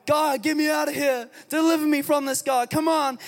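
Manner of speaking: a pretend prisoner crying out for rescue; desperate prayer. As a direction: beg God to get you out. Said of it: "If you're crying out".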